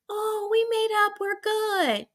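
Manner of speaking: high airy whisper of fake friends making up; breathy fake-nice mock of reality stars; mock fake reconciliation. Mocking reality stars claiming they made up, high fake whisper.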